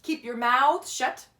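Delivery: loud, harsh command; barked order. A narrator's reading. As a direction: bark it at the class. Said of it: "said Miss Swamp".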